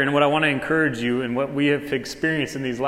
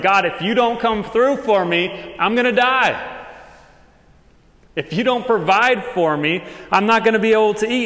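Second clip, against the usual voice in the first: desperate plea; 6 semitones higher, 4 dB louder than usual. Acted. Voicing a desperate prayer to God.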